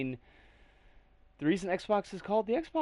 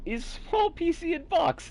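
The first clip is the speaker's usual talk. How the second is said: mock Russian accent, higher and slow; 8 semitones higher, slower than usual. Streamer reading a comment in a mock Russian accent. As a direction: read it like a joking Russian. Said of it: "why did I read that in a, a Russian accent".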